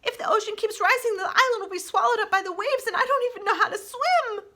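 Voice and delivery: high, frightened chatterbox; worried. Acted. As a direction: panic a little about the rising sea. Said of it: "eyes grew wide with concern".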